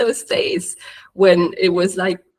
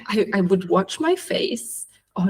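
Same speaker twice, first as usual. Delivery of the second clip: ordinary delivery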